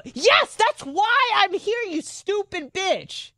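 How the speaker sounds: high-pitched, drawn-out mock outrage; exasperated retort. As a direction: snap back in an exaggerated, high, slow yell. Retold angry retort to receptionist.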